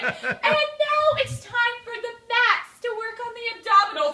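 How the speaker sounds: high, singsong instructor announcement; imitated class instructor. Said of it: "And then she was like".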